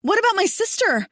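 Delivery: high, loud pleading call; desperate cry. Acted. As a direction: plead for her sister at full voice. Woman cries after him.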